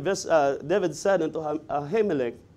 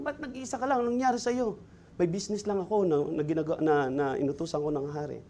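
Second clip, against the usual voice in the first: much softer, a secretive back-and-forth; hushed exchange; 3 semitones lower, 8 dB quieter, faster than usual. Acted. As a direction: keep it low, as if no one may overhear. Priest and David trade hushed words.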